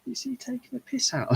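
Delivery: imitated mocked bloke. Bloke wondering if he's being mocked, high imitated voice. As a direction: voice a puzzled stranger's high-pitched grumble. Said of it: "this bloke's going".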